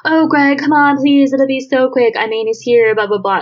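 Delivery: high, pleading mock coaxing; placating. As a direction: sweet-talk him into it, hammed up. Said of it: "like, placating".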